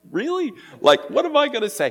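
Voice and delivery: high, startled disbelief; a sudden high-pitched really, then a fast worried question; surprised. Retold or imitated. To John, startled at being asked to speak. Surprised reply to pastor's request.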